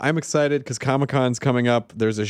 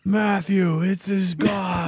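higher, shouting off to the side; mock shout; 6 semitones higher, much slower than usual. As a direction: call out to the room in jest. Playful shouting bit.

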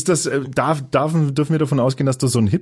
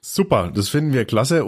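ordinary talk

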